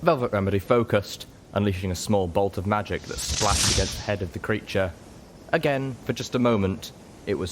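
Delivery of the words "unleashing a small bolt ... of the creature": ordinary delivery, nothing marked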